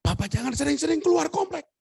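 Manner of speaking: high-pitched wifely warning; mock high nagging caution; imitated wife. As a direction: warn in a high voice, playing the wife. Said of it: "Terus istri saya bilang".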